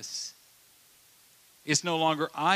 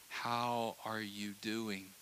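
softer, slow, gentle question; tender Jesus; 3 semitones lower, 8 dB quieter, much slower than usual. Retold or imitated. Jesus asks the hurting one, soft and slow. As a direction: ask it with tender care. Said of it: "he's saying".

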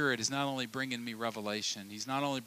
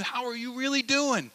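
much higher, emphatic "doing"; pressing ask; 11 semitones higher, 4 dB louder than usual. Retold or imitated. Friend demands honest answer, high and urgent. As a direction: push the question up hard. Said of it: "They're saying".

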